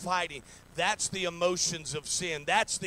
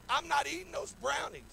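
daughter refusing; 10 semitones higher than usual. Retold or imitated. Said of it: "She said".

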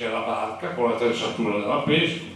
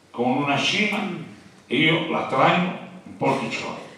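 ordinary talk

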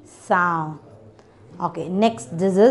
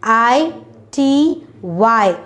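spelled out slowly; much slower than usual. Shown as a demonstration.

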